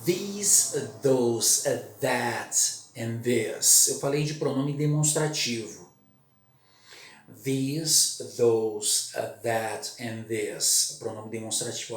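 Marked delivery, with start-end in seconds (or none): 0.1-3.9 "These, those, that and this" with a buzzing TH; "these" and "those" with the tongue between the teeth; voiced TH. Shown as a demonstration. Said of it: "duas formas básicas de falar T H". 7.4-11.0 "These, those, that and this" with a buzzing TH; "these" and "those" with the tongue between the teeth; voiced TH. Shown as a demonstration. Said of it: "A minha língua vai entre os dentes".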